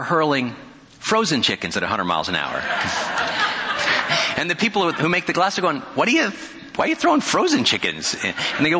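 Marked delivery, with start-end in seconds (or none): 2.7-4.3 high giggle. Real, not acted. Laughing at absurd chicken test. 5.9-8.1 hammed-up shocked glassmaker shouting his question; baffled outrage. Glassmakers baffled at locomotive people.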